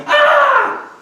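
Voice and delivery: very high, loud scream; mock scream. Rider free falling between the singers' wails, high loud scream. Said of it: "I'm going".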